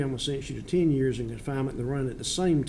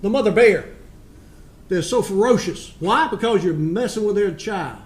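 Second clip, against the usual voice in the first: stern scolding; 3 semitones higher, 5 dB louder than usual. Real, not acted. Judge scolds defendant at sentencing.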